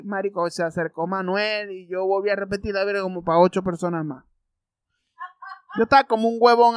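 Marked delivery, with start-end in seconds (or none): none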